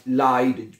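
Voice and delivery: लाइड with "ai" as in English eye, slow; leid as lide. Shown as a demonstration. Teacher correcting pronunciation of leid for students, slow and clear. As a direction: say लाइड rhyming with ride, not "leed". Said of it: "आई की तरह pronounce करेंगे".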